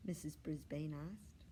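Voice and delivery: plain reading voice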